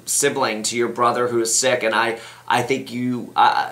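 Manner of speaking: ordinary talk, nothing marked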